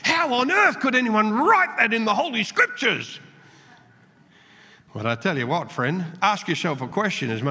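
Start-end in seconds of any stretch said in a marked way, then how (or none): 0.0-3.2 a touch of mock shock, pitched higher; scandalised teen. Preacher recalling his teenage self reading the Bible, mock scandalised. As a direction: lightly play a shocked young reader. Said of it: "a teenager thinking".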